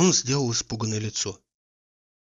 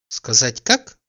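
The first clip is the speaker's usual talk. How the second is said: a touch louder, playful sly tease; slyly teasing; 5 dB louder, much slower than usual. A narrator's reading. She teases Oblomov slyly in the boat. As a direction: dangle the answer to tease him. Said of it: "лукаво дразнила она".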